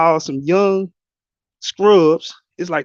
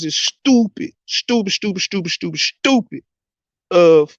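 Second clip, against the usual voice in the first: mocking sing-song; 3 semitones higher than usual. Real, not acted. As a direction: tease it out like a playground taunt. Mocking rant about Grambling hire.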